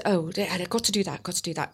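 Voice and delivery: fretful inner murmur. Retold or imitated. Retold racing night thoughts.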